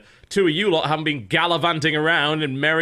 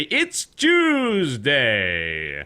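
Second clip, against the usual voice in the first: very slow, drawn-out Tuesday; Giant Bomb host impression; much slower than usual. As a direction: stretch it out like a podcast host. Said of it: "my best giant bomb impression".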